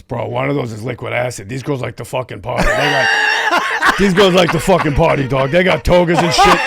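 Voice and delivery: loud, rising excited impression through laughter; hyped-up party bro. Imitating Joey about liquid acid, hyped-up party bro.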